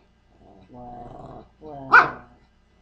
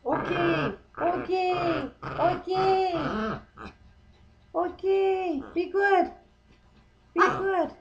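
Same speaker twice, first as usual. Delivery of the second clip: high soothing singsong; calm the animal down sweetly; soothing pet voice; 7 semitones higher than usual. Real, not acted.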